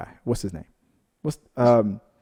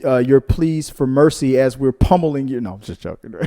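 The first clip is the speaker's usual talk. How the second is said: usual speaking voice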